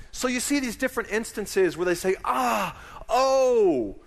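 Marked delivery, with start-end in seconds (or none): 2.2-4.0 high, loud cry of pained surprise. Shown as a demonstration. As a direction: show the pain and surprise of awe. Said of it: "It's surprise, it's pain".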